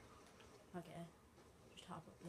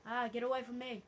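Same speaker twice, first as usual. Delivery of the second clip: loud, raised-pitch plea to back off; alarmed shout; 5 semitones higher, 9 dB louder than usual. Real, not acted. Player fending off an enemy, alarmed shout. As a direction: yell it to drive off an attacker.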